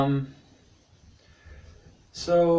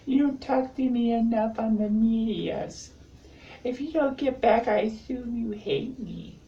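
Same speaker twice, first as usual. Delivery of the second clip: hammed-up needy complaining up high; mock needy whine; 13 semitones higher than usual. Nagging a crush for attention, high mock needy whine. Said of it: "it more came out like".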